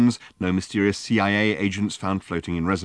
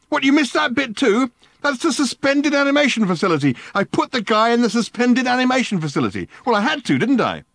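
pitched way up and loud; high, loud agitated Ford; 14 semitones higher, 5 dB louder than usual. A narrator's reading. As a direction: keep the frantic shriek going. Ford shrieking on.

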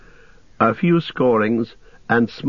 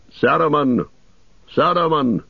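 high, commanding call, measured in volume; commanding cry; 6 semitones higher than usual. A narrator's reading. Wizard summons Saruman from the tower, commanding cry. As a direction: call him out with authority, pitched up. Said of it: "he cried in a loud, commanding voice".

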